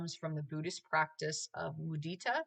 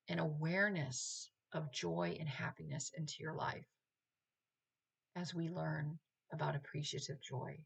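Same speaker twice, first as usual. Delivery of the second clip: guided meditation; 4 dB quieter than usual. Acted. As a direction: ease them gently into calm. Leads the meditation proper.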